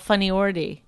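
"Funny Ordy" with a dee ending; mispronounced OR-dee. Retold or imitated. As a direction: run "Ordy" together as OR-dee. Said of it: "she thought it was called".

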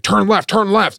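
high-pitched, repeated urgent order; frantic demand. Retold or imitated. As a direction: insist frantically, imitating the passenger. Acted passenger grabbing the wheel.

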